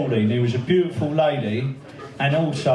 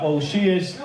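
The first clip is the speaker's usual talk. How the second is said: normal talking voice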